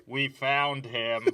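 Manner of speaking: slow muffled radio call; walkie-talkie voice. Acted. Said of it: "this didn't work good".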